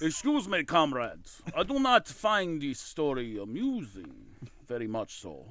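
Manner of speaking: put-on slow, low Russian accent; stern Russian officer. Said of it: "the only voice that Tex can do is Russian".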